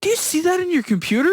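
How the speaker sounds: high-pitched old-lady imitation; high mock old-lady voice; little old lady. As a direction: ask it as a sweetly needling old lady, pitched well up. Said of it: "changes his voice to a little old lady's voice".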